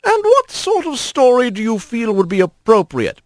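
put-on high, knowing old lady voice; grandma playfully doubting the kids; amused grandma. A narrator's reading. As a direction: tease them fondly.

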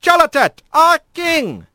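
high-pitched royal announcement; herald's call. Acted. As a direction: proclaim the king's arrival in a high, ringing call. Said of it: "a call from behind stopped her".

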